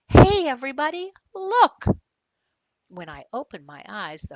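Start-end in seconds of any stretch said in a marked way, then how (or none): 0.1-1.9 very loud, high excited shout. A narrator's reading. Bully boys shouting to everybody, high and loud. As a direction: yell to the whole gym. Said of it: "Ben and Dylan shout".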